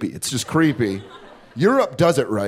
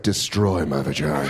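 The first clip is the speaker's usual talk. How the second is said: much lower, softer sultry voice; 13 semitones lower, 4 dB quieter than usual.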